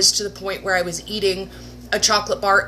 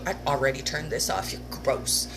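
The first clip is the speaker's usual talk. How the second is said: softer, fast, under the breath; muttered aside; 7 semitones lower, 8 dB quieter than usual. Real, not acted. Grumbling at the diet guy video, muttered aside. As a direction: grumble it to yourself.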